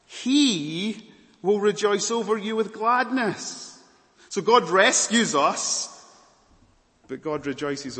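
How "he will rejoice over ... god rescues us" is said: loud, slow, high pulpit proclamation; booming sermon emphasis; preaching at full force. Acted. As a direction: proclaim it to the back row.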